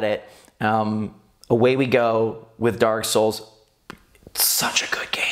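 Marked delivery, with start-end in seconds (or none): 4.3-5.3 hushed, whispered praise; whispered aside. Real, not acted. Host introducing a Dark Souls clip, whispering.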